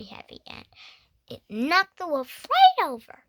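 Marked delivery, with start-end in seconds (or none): 1.3-3.2 excited climax. A narrator's reading. Climax of the story.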